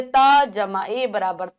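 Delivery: very slow, each part held apart; slow spelling out. Shown as a demonstration. Teacher slowly spelling a syllable for children. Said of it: "ए की मात्रा इस प्रकार".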